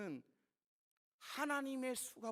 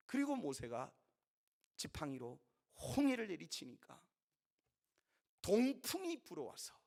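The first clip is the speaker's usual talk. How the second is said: ordinary delivery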